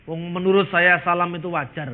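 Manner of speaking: ordinary delivery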